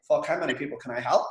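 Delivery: ordinary talk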